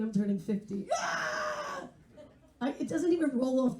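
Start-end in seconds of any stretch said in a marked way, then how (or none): 0.0-1.8 mock despair. Dreading turning fifty.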